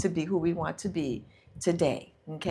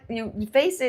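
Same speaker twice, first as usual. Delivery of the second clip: normal talking voice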